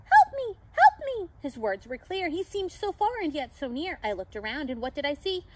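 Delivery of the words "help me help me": high, loud squeal for help; wee little pixie voice. A narrator's reading. Lost pixie calling from the snow, wee cry. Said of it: "A wee little voice began to cry".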